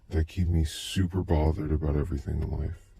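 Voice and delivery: ordinary delivery, nothing marked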